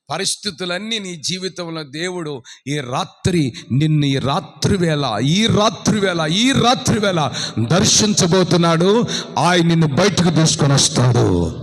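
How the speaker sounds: builds from plain to loud proclamation; rising preaching. Acted. Preacher promising the crowd, building loud.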